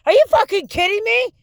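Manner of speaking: indignant disbelief. Real, not acted.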